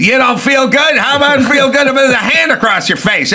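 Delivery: high, laughing hammed-up threat; old-movie bully; mock tough guy. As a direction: threaten like a thirties gangster. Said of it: "acted radio threat".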